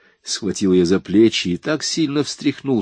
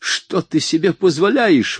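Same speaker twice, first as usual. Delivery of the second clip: higher, louder indignant cry; outraged cry; 9 semitones higher, 4 dB louder than usual. A narrator's reading. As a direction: she can't believe his nerve. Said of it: "вне себя от возмущения вскрикнула она".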